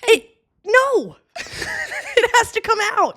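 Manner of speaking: high, laughing protest; playful high-pitched refusal. Real, not acted. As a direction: squeak it out through a laugh.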